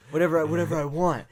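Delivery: loud, quick mock panting; out of breath. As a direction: push it out as if badly winded. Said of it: "she's, like, out of breath".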